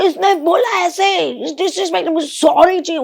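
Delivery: imitating Vicky. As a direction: mimic a sulky man demanding an apology. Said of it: "उसमें विक्की आ गया".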